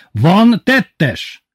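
high, loud repeated demand; bellowing. A narrator's reading. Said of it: "bömbölte Titi". (dühös bömbölés)

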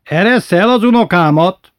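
high, loud indignant demand; commanding demand. A narrator's reading. Grandmother demanding the man release her grandson, loud and indignant. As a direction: order him to let go.

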